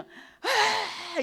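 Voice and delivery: put-on high loud child wail; a grandchild crying out loud; child's wail. Child crying out while grandma waits, loud wail. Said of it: "이렇게 울어요".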